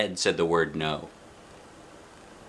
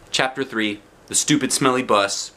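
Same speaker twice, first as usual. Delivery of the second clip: ordinary delivery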